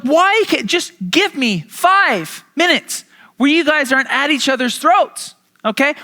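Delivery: put-on a parent begging the kids for peace; exasperated parent. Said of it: "parents in the room".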